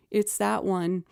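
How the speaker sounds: normal talking voice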